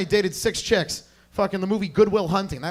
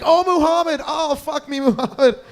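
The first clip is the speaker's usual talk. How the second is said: high, hammed-up lover's wail; put-on high moans of ecstasy; mock ecstatic cries; 8 semitones higher, 4 dB louder than usual. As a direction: imitate a lover calling out in passion. Mimicking a lover's cries in sex.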